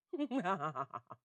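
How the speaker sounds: loud, high gloating laugh; hammed-up laugh at their misfortune; mocking laugh. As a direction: burst into a theatrical cackle. Schicchi laughs at the relatives.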